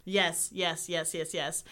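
ordinary talk

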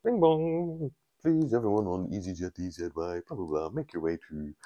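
mock gate call, trailing into blahs; airport tannoy announcement. Announcer calling passengers to the gate, muffled tannoy drone. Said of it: "you hear a gate being called".

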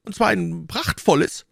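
high-pitched, eager innkeeper; eager call. A narrator's reading. Innkeeper praising the horse, eagerly calling out. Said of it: "rief der Wirt".

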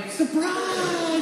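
high, drawn-out "surprise" cry; high-pitched mock party yell; surprise shout. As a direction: imitate kids springing a surprise. Children practising a surprise shout.

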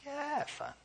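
put-on high, soft little-boy voice; a hint of a kid saying he has fun; imitating his son. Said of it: "He's like".

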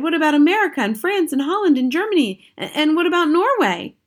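high, loud urgent question; loud boyish protest; cried out. A narrator's reading. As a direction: demand it of grandmother. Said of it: "I cried".

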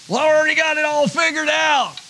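a hint of a smug skeptic; smug know-it-all. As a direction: parody someone who thinks they know it all. Mocking a complacent churchgoer.

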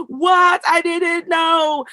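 high, loud cry of surprise, then a slow I didn't know; mock shock. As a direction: act out stunned people discovering history. Acting people's shocked discovery.

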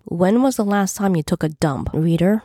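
plain reading voice

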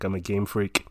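usual speaking voice

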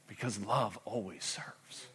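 slow soft whisper, "love" rising. Acted. Pastor whispering the lesson to the church. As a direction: whisper the truth home.

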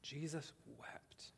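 slow whisper, Jesus stretched out; hushed whisper. Acted. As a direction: let it fall to a breathless whisper. Climax of the reading.

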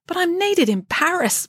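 high, slow plea; Friday pleading with the head nurse. Acted. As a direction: beg to be let go. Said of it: "pleaded Friday".

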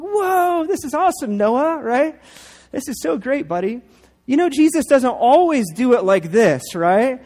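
high, bright-eyed wonder at the gift; excited praise. Retold or imitated. Parents cheering their son over the package, excitedly high.

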